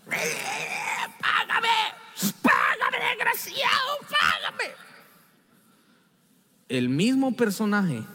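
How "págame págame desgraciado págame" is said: high strained shout of rage; mock furious shout; furious demand. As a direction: scream at the debtor to pay up. Servant choking his debtor.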